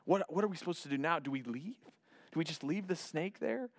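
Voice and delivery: hushed, urgent stage whisper; acted whispering. Moses and Aaron confer about the snakes, worried whispering. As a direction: whisper it like a worried aside, partly voiced. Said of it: "whispering to each other".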